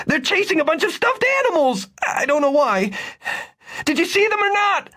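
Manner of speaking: frantic shouting. A narrator's reading. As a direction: plead desperately to be believed. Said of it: "Victor shouted".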